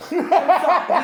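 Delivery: bursting into laughter; laughing out loud. Real, not acted.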